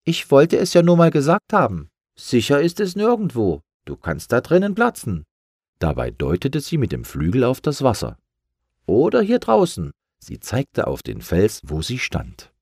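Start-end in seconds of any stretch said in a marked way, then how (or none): 0.1-5.2 high, louder sneering gull voice; mocking gull. Gull taunts balloon. 8.8-9.9 high, louder sneering gull voice; mocking gull. Gull taunts balloon.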